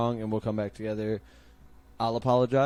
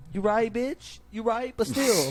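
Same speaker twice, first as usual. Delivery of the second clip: high, louder mock voice, sassy; mock high retort; 11 semitones higher, 5 dB louder, slower than usual. Answering his wife in a mock high squeaky voice. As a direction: toss it back in a hammed-up squeaky voice.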